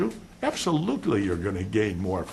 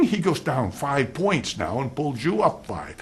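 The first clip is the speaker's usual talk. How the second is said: ordinary delivery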